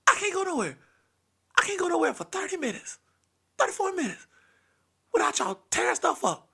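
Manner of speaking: high, strained disbelief in short bursts; exasperated grandpa. Retold or imitated. Grandpa scolding the kids from the doorway, high exasperation. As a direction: build from muted to high-pitched complaint.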